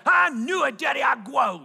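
put-on high, loud little-girl cheer; triumphant toddler. Daughter seeing the new line on her growth board, high triumphant shout. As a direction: crow it like a kid proven right. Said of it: "she steps back and she looks out".

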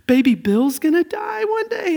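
high, loud imitated child's wail; upset little boy. Father imitating his son worrying about the baby. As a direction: wail it like a worried small child.

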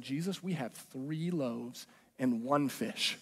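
soft, slow, sheepish little report; mild quiet report. Retold or imitated. Disciples sheepishly telling Jesus the short count, softly. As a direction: mutter it like an embarrassed disciple.

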